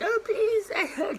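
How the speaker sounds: high, overdone fake begging; mock pleading. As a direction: ham up a teary plea. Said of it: "like some Instagram follower".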